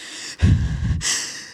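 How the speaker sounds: acted gasping for air; mimed breathlessness. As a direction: heave voiceless breaths as if winded. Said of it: "I can't get air in my body".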